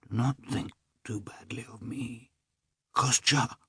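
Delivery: a near-breaking farewell whisper; dying brother's whispered farewell to Kostya; trembling voice. A narrator's reading. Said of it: "his voice trembled".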